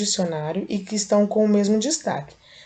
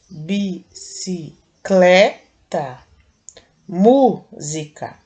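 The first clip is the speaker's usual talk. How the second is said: "Bicicleta" and "música" said slowly, leaning on "clé" and "mú", with "música" much louder; slow, emphatic syllables for the class; stressing the strong syllable; 5 dB louder, much slower than usual. Shown as a demonstration. Teacher to children, showing the tonic syllable, slow and emphatic. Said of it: "a gente fala de um jeito mais forte".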